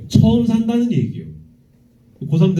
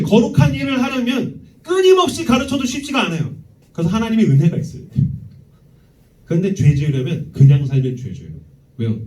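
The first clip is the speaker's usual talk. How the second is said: ordinary talk, nothing marked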